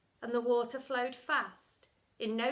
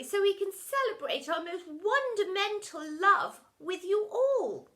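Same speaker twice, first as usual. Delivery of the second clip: higher, lovey-dovey voice; higher soppy mother voice; 8 semitones higher, 5 dB louder than usual. A narrator's reading. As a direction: gush like a doting mum. Soppy Lovey-Dovey parent speaks.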